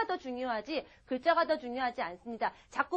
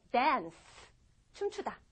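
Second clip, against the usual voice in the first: usual speaking voice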